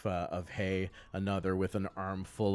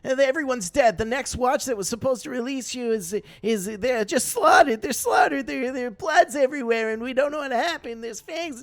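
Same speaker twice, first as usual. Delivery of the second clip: high, loud, breathless panic about the slaughter; hysterical panic; 9 dB louder than usual. Acted. Pio breaks the news of the camp, in high hysterical panic. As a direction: gasp it out, near hysterics. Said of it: "she's starting to go into hysterics".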